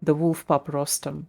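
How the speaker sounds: ordinary talk, nothing marked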